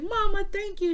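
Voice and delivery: high, sing-out thanks to mom; grateful child. Retold or imitated. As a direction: imitate your younger self thanking mama. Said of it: "And I'd be like".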